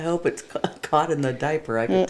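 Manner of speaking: loud amused outburst; loud exclamation. Real, not acted. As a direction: call it out loud. Baby blowout mishap.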